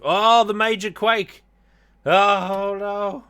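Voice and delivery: high, loud cry of alarm; shocked shout. Real, not acted. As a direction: yell in sudden shock. Sudden game disaster.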